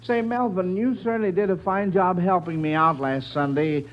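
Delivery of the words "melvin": normal talking voice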